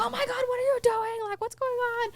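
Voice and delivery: high, fast, startled cry of a woman caught off guard; shocked homeowner. Retold or imitated. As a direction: shriek it out like you just found strangers in your house. Startled homeowner confronts intruders.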